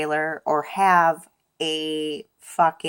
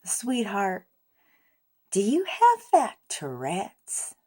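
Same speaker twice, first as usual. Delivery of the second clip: sweetest tone; 3 semitones higher, 4 dB quieter than usual. Retold or imitated. Old lady gently asking the swearing driver, sweet and soft. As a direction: coo it like a kindly grandma. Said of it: "asks in the sweetest tone ever".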